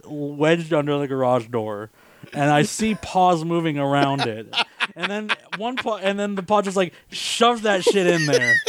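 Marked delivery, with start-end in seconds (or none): none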